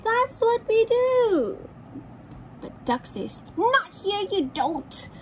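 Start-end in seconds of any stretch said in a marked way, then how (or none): 0.0-1.6 high, slow little insect voice; dragonfly voice. Acted. Dragonfly answers Duck. 3.6-5.1 bossy duck. Acted. Duck forbids dragonflies.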